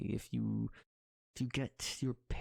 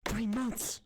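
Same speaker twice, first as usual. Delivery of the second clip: indignant exclaim; 9 semitones higher, 3 dB louder than usual. Acted. Exclaiming in argument.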